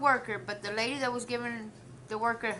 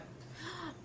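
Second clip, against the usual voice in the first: retold girl's gasp; 9 semitones higher, 14 dB quieter than usual. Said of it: "the little girl was like".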